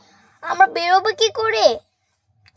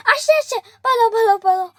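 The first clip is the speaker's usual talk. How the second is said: frantic; 7 semitones higher than usual. Real, not acted. Doll approaching, urging flight.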